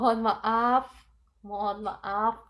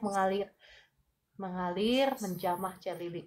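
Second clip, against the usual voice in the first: fervent healing prayer. Acted. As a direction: pray with rising force, pressing in. Healing prayer over a viewer. (doa penyembuhan yang berapi-api)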